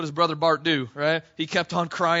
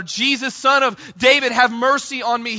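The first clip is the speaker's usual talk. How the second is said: loud, high pleading shout; shouting even louder; 5 semitones higher, 6 dB louder than usual. Retold or imitated. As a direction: call it out over the crowd. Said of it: "he shouts out even louder".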